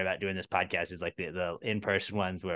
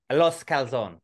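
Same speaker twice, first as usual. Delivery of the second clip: mock Spanish accent; 4 semitones higher, 4 dB louder than usual.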